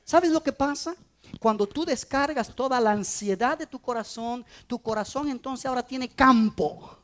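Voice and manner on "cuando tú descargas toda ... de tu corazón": ordinary delivery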